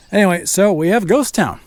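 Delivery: ordinary talk, nothing marked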